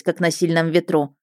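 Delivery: plain reading voice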